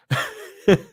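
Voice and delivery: wincing, breathy laugh that hurts; laughing through pain. Real, not acted. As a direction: laugh while your ribs ache. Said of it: "Oh, it hurts to laugh".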